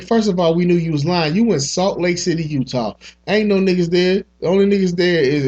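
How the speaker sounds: ordinary delivery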